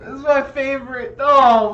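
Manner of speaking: high, loud mock bawl. Zebediah cries over his broken doll, bawling. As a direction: wail it like a grown man crying. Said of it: "and he's just bawling".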